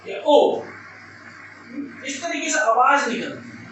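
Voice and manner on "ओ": loud sudden "ओ" as if hurt; pained cry. Shown as a demonstration. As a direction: yelp of pain from a thorn. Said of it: "तुरंत अपने आवाज में".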